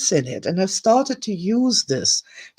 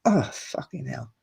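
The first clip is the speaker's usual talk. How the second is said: low, soft despairing mutter under the breath; 7 semitones lower, 9 dB quieter than usual. Retold or imitated. Retelling to viewers how she gave up, soft despairing mutter. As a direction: mutter it like you've given up. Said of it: "I just went".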